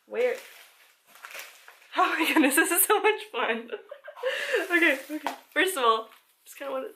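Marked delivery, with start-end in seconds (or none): 3.7-4.7 high giggle. Real, not acted. Giggling at the box contents.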